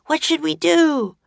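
high-pitched, urgent cry for help; alarmed cry. A narrator's reading. Jack asks Mamoon as bandits approach, alarmed cry. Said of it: "cried Jack".

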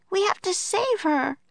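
high, urgent plea; Annie's cry. A narrator's reading. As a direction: cry it out in a high-pitched character voice. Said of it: "cried Annie".

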